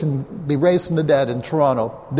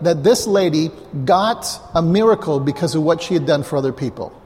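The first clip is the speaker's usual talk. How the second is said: full-force preaching; 4 semitones higher than usual. Acted. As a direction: drive the question home to the congregation. Preacher drives home the point.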